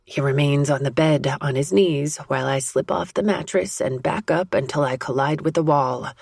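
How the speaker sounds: ordinary delivery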